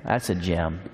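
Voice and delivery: usual speaking voice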